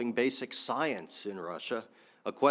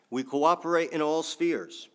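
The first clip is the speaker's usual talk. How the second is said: a hint of a Putin voice, higher and louder; quoting Putin; 6 semitones higher, 6 dB louder than usual. Analyst quoting Putin in a hint of his voice. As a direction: lightly voice Putin, a bit raised. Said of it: "I don't do a good Putin accent".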